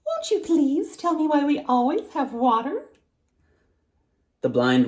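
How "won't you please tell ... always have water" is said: put-on high, sugary little girl; sweetest voice. A narrator's reading. Girl pretending to be granddaughter asks the blind woman, high and sweet. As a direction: coax it sweetly like a sly child. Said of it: "she asked in her sweetest voice".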